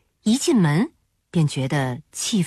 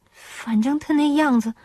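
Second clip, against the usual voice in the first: halting, uneasy, higher voice; glum; 5 semitones higher than usual. A narrator's reading. Woman admits fear of his mother, glum and hesitant. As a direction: confess it hesitantly, with little stammers. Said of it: "郑娟仍然很郁闷地说".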